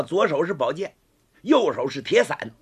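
ordinary delivery

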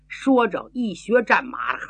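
ordinary delivery, nothing marked

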